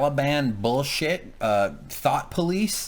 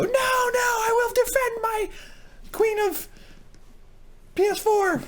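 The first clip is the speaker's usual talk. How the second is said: mock heroic shout. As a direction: swear to defend it with hammed-up passion. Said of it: "And you go".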